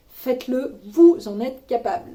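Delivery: a loud push of confidence; rousing encouragement. Real, not acted. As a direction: pump the viewers up. Encouraging viewers.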